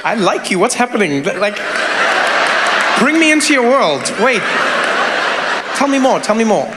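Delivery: ordinary talk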